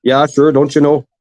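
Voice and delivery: lightly put-on Minnesota-style twang; hamming up a Midwestern folksy accent; Upper Midwest accent. Keeps up the Wisconsin bit.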